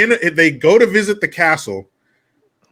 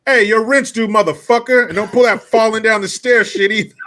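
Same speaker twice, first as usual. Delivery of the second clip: higher, louder rough landlord demand; pushy landlord voice; 6 semitones higher, 3 dB louder than usual. Retold or imitated. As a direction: bark it like a shakedown landlord. Voicing landlord demanding rent.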